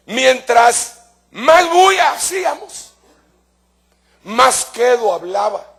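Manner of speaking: recalling the noisy classroom, pitched high and drawn out; emphatic exclaim. Real, not acted. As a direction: build it up high and slow.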